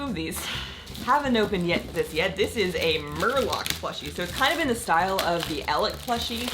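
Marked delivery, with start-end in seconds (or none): none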